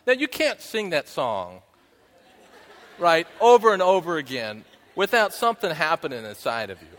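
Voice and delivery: talking loud through laughter; laughing. Real, not acted. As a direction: let the laugh carry the words. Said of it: "still laughing after song".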